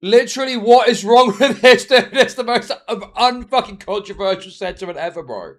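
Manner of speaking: frustrated ranting shout; exasperated. Real, not acted. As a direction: demand it in real disbelief, full voice. Repeats the question in exasperation.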